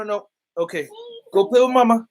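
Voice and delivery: ordinary talk, nothing marked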